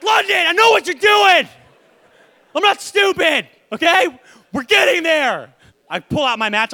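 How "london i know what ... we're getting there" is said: loud, high mock fury; mock yell at a nagging child; dad pretending to threaten burning the toys; hammed-up angry parent. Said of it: "I'm like".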